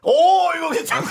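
high, loud, dead-level robotic tone; machine voice. Pretend. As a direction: speak like an old speech synthesizer. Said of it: "우리 기계음으로".

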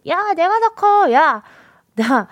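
high-pitched mock child bickering; squabbling kids. Radio host imagining twins arguing over height, squabbling kid voice. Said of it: "이러면서 막 싸우고 있을 거 아니에요".

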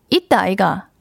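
Gyeongsang "있다, 아이가" trying the accent; Busan-style 있다 아이가 with a falling end; Gyeongsang dialect. Shown as a demonstration. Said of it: "이거 맞나?". (경상도 사투리로 "있다, 아이가")

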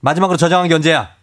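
high, urgent barked commands; drill sergeant. Acted. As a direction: order everyone to freeze like a drill sergeant. Boss barks orders in panic.

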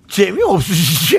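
exaggerated, drawn-out mocking question; imitating co-host's sarcasm. Host mimicking co-host's rude take, slow mocking exaggeration. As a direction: stretch it out, jeering. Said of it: "한윤서 씨가".

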